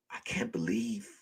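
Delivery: very soft, low and stunned; barely voiced, imitating her hurt; retold hurt murmur. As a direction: act out quiet disbelief.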